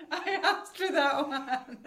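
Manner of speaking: drawn out, laughing and embarrassed. Retold or imitated. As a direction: savour the embarrassment slowly. The same line repeated, slower.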